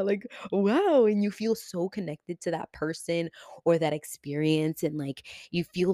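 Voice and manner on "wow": amazed. Acted. Acted amazed reaction.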